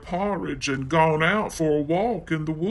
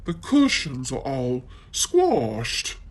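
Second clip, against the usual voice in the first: Mommy Bear voice; 2 semitones lower than usual. Acted.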